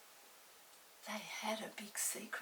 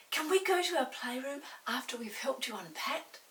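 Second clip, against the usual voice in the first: put-on higher, louder hopeful boy; eager boy; 4 semitones higher, 6 dB louder than usual. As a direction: ask hopefully. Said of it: "asked Peter".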